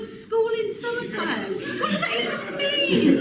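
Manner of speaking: lebih tinggi, bingung pura-pura; mock baffled. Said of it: "I was like".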